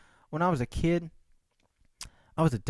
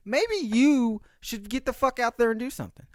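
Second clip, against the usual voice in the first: higher, self-scolding voice; scolding inner voice; 7 semitones higher than usual. Retold or imitated. Talking to his couch-potato self, mild mock scolding. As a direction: nudge yourself like a buddy. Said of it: "And I was like".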